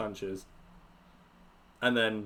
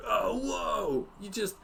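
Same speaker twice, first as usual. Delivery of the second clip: high, stunned bro voice; amazed bro; 8 semitones higher, slower than usual. Acted. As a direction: wow like a hyped bro. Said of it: "Gork's like".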